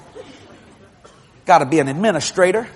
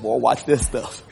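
lightly voiced God; 8 semitones lower, 5 dB quieter, slower than usual. Voices Jehovah slowly.

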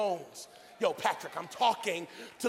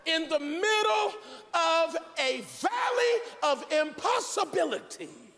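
dramatic preaching; 7 semitones higher, 4 dB louder than usual. Acted. Preacher setting the valley scene, dramatic preaching. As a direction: draw it out with rising pulpit force. Sermon builds to God's question.

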